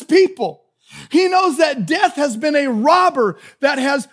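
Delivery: loud, high-pitched pulpit delivery; impassioned preaching. Real, not acted. Preacher warning the congregation about complainers, impassioned and loud. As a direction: preach it out at full force, hammering each phrase.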